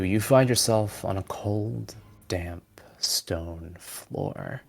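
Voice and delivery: normal talking voice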